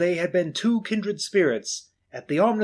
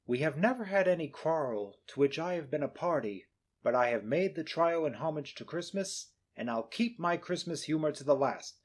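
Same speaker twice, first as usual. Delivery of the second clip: soft, sincere, quieter farewell; gentle regret; 8 dB quieter than usual. Acted. Nephew taking leave of Scrooge, soft and sincere. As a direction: part kindly without anger.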